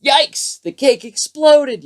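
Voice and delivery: high, shocked yelp, the second part slow; father yelps at the exploded cake. A narrator's reading. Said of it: "yelped Froggy's father".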